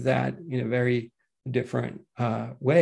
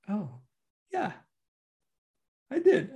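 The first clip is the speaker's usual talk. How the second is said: put-on little girl; 8 semitones higher, 3 dB quieter than usual. Grandchild answers grandma in story.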